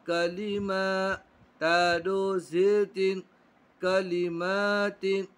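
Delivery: slow, drawn-out recitation of syllables; Quran spelling drill. Shown as a demonstration. Teacher drilling pupils in Quran spelling, slow chant. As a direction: spell it out syllable by syllable, stretching long vowels.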